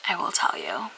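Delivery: hushed secret whisper. Acted. Teasing reveal.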